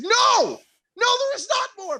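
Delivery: very high, loud retold no; reliving telling the cook off; squealing mock protest; retold outburst.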